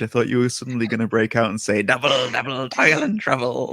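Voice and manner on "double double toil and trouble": cackly witch. Pretend. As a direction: chant it like a stage witch. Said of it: "gonna sound like a cackly witch".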